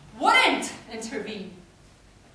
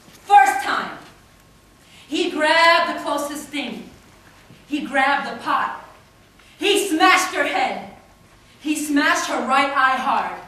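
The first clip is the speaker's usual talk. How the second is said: loud, hard-hit poetic phrases; forceful outburst; 11 dB louder than usual. Acted. Poet recounting a man's first beating of her, forceful. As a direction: hammer out each blow loudly.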